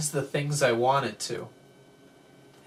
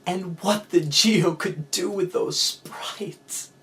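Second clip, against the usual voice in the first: slow, higher, louder swoon; swooning excitement; 8 semitones higher, 6 dB louder, much slower than usual. Acted. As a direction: gush over it like a crush. Swooning over the hardware.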